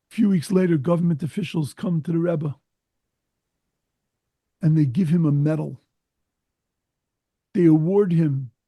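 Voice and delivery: ordinary talk, nothing marked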